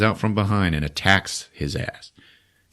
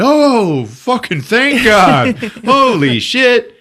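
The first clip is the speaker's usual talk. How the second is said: relieved exclaim; 6 dB louder, much slower than usual. Retold or imitated. Friend stirs, relief.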